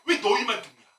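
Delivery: mock-furious preacher yelling orders; angry ranting. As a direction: rant the orders like an angry pastor. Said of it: "처음에는 화가 났죠".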